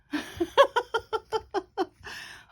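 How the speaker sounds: breathy giggle out of tiredness; tired high laugh. Real, not acted. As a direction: laugh at your own exhaustion. Tired after mowing the lawns.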